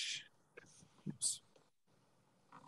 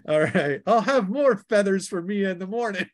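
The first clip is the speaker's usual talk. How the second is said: high, louder, laughing boast; gleeful warrior taunt; 8 semitones higher, 5 dB louder than usual. Acted. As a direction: crow over the birds while laughing. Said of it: "You're a loony".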